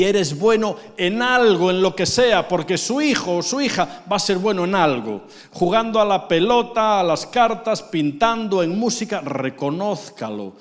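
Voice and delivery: impassioned sermon; preaching shout. Real, not acted. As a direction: urge it on the fathers with fire. Preaching climax.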